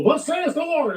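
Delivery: high-pitched mock-prophetic proclamation. Speaker mimicking people who claim the Lord's word, mock-grand. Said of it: "you know, some of these people".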